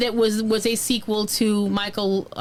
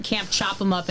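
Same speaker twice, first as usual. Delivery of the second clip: ordinary delivery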